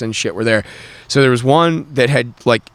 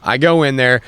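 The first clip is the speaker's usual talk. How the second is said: ordinary delivery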